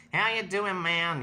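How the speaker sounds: laid-back American "man" voice; American accent. Shown as a demonstration. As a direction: greet them in a put-on American accent. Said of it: "almost like an American accent".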